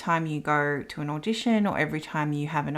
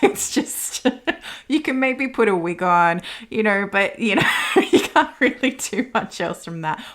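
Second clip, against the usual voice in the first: laughing; 4 semitones higher, 5 dB louder than usual. Real, not acted. Laughing about looking like Johnny Depp.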